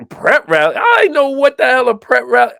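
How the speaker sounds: high-pitched comic incredulity; baffled disbelief. Retold or imitated. Telling co-host about unfamiliar school customs, incredulous high voice. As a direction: question it, then rise into amused bafflement. Mock bafflement at prep rallies.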